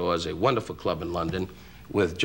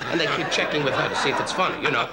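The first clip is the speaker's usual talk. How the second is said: normal talking voice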